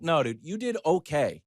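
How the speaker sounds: ordinary talk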